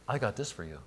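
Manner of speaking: low, soft and calm; quiet reassurance from God. Acted. God reassuring the hesitant believer, low and soft. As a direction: hush it like a promise. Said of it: "God says".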